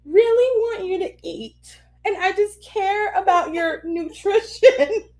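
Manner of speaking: high, teary, laughing through it; laugh-crying plea. Retold or imitated. Mom to daughters about nutrition, high laugh-crying. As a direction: plead between laughs and tears.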